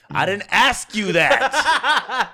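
high, loud, slow mock rage through laughter; half-laughing, hammed-up fury at the producer; retold furious outburst. Reliving his outburst at the producer in the bathroom, loud, slow mock fury. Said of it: "And I fucking got furious".

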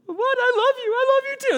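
very high, loud squeal, imitating an overcome woman; hammed-up sobbing joy in falsetto; mock tearful Mary. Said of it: "you know, she's sobbing".

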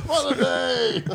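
silly echo. Pretend. Echoing cohost in silly voice.

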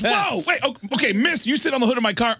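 high, fast flustered cop; mock startled cop. Cop rushes to separate the couple, flustered and high. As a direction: act hurried and thrown off. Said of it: "the cop goes".

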